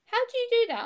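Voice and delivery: imitating amazement, very high pitch; amazed questioning. People asking how she changes so much, amazed. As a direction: voice jumps right up in retold wonder.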